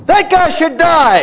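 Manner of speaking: very high, loud, slow outraged outburst; David outraged. Retold or imitated. King David judging the thief in Nathan's story, outraged shout. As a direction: explode in righteous fury. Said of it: "And he's like".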